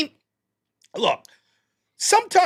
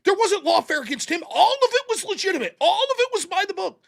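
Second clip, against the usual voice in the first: mock righteous liberal; 11 semitones higher than usual. Mimicking opponents' claims.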